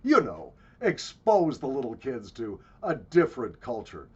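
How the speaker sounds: loud, hammed-up self-satisfied tourist; mock well-off dad showing off; smug corporate parent. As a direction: boast with big fake laughs and swooping pitch. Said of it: "Mom and Dad Corporate".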